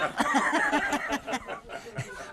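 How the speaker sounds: high, airy unvoiced laughter; breathy silent laugh. Real, not acted. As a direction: laugh without voice at your own joke. Teller cracks up at punchline.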